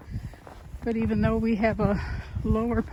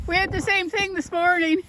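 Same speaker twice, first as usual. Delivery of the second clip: raised, higher voice across the street; calling out; 9 semitones higher, 5 dB louder than usual. Real, not acted. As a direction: call out cheerfully to a neighbour. Calling out to a neighbour shoveling.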